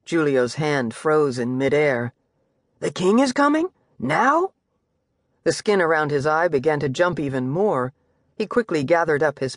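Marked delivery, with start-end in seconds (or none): 2.8-4.5 high, alarmed question rising sharply; a nervous son caught off guard; startled boy. Acted.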